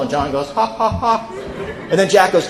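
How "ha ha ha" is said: softer staccato mock laugh; a buddy's canned laugh, played small; imitated laugh. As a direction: put on three evenly spaced ha's. John's laugh repeated in story.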